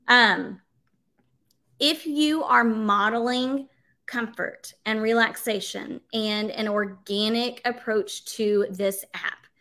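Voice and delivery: ordinary talk, nothing marked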